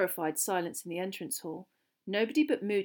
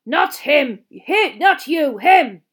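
loud, slow, higher growl; growled bark; 7 semitones higher, 15 dB louder, much slower than usual. A narrator's reading. Teacher correcting Harry about who he means, growling. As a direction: growl each phrase out forcefully. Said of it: "Moody growled".

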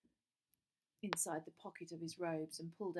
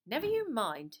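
dismissive brush-off; 4 semitones higher, 8 dB louder than usual. A narrator's reading. Dismissive teacher.

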